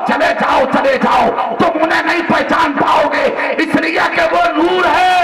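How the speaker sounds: full-force preaching shout; shouted climax preaching. Acted. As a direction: hammer the point home to the crowd.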